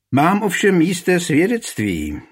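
a bailiff scoffing, wounded pride; offended smirk. A narrator's reading. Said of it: "ušklíbil se rychtář Savinec uraženě". (uražený úšklebek, vyšší hlas)

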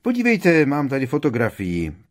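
forced jolly showing-off; cheerful. Acted. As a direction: brightly push the photo forward. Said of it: "pokračoval bodře Lupin".

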